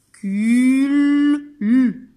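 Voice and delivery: "Cul" high, loud and long into a held "L"; final-sound demonstration. Shown as a demonstration. Teacher making children hear the L, "Cul" stretched into a hummed "L". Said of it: "j'entends L".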